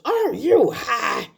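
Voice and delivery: mock-crying shout, very high and slow; incredulous shriek. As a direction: shriek it in tearful disbelief like the film actress. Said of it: "it's the way Shirley MacLaine says".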